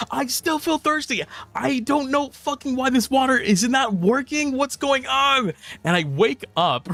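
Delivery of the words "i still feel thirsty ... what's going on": retold panic, much higher; voicing his dream self in a mock panic; high, frantic mock panic; panicking. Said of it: "I start panicking".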